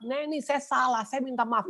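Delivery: high, softened pleading; retold tearful apology. Pastor begs Neni's forgiveness by phone, acted tearful apology. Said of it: "Itu kita nangis-nangisan dua-dua".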